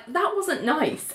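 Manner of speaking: soft, slow wounded line; hurt Elaine. Retold or imitated. Elaine scolding Ali, soft slow hurt voice. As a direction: say it small and hurt. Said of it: "And Elaine's like".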